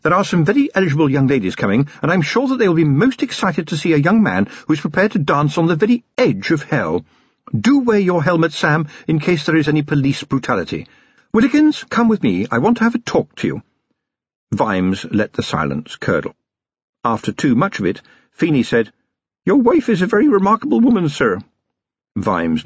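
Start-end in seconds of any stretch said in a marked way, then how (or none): 0.0-13.6 high-pitched lady, cheerfully in command; brisk social chat turning to orders; self-assured wife in a high, lively voice; cheerful hostess. A narrator's reading. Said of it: "she said cheerfully". 19.4-21.4 put-on high-pitched, earnest young policeman; respectful youth's voice, pitched well up; quick, high and impressed; awed young constable. A narrator's reading. Young constable addressing his prisoner.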